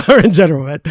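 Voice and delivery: loud, high, laughing add-on. Real, not acted. Host reassuring the teary guest he needn't apologize, laughing. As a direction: break the tension with a laugh.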